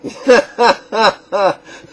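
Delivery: loud, high burst of laughter; real laugh, high and loud. Real, not acted. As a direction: burst out laughing at your own remark. Laughs after his own remark.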